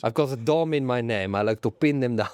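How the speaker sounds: put-on comic Italian accent; Stefano Domenicali. Boss introducing himself to the public, Italian accent. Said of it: "I'm Stefano Domenicali".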